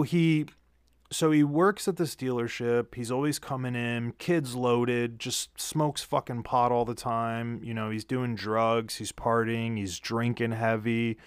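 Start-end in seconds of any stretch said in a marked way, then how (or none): none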